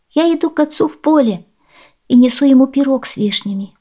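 put-on high, girlish answer; high-pitched child's voice; little girl. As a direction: explain politely as a young child. Girl answers old woman.

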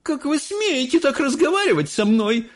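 high-pitched outraged lady; indignant cry. Acted. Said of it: "закричала миссис Солт". (возмущённый высокий вскрик)

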